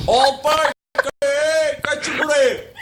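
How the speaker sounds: high, loud warning cry; alarmed shouting. Acted. Man warning everyone to get away, alarmed shouting. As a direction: call out for everyone to run.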